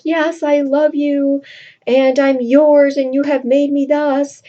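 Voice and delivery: mock breathless romantic heroine; swooning Roxanne. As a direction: melt into the confession. Roxanne declaring love to Cyrano.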